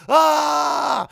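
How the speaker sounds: long, high, loud held "aah" scream; wild sustained holler; crazy scream. Acted. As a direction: let out an unhinged freak-out yell. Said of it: "I don't know if we want crazy".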